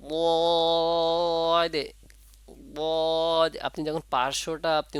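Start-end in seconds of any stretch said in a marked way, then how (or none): 0.1-3.6 very slow, sustained "বদে" with a long steady vowel; drawn-out letter demo. Shown as a demonstration. Teacher shows students the correct letter sound, drawn-out demo. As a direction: hold the vowel of "বদে" long on one higher, level note, then close on d. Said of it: "উচ্চারণটা হচ্ছে। যেমন".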